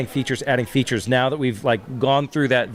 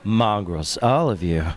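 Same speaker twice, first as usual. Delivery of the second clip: Gilgamesh voice; 3 semitones lower, slower than usual. Pretend. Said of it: "role as Gilgamesh".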